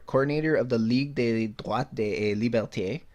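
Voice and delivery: ordinary talk, nothing marked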